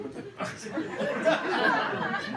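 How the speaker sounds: high, slow startled squeal; hammed-up shocked yelp; mock shock. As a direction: squeal in disbelief. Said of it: "I'm like".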